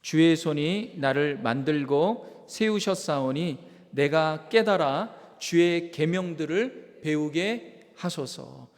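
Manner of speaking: slow, loud scripture reading aloud; congregational reading. Shown as a demonstration. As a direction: lead the congregation in reading the verse. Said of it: "다 같이 한번 읽도록 하겠습니다".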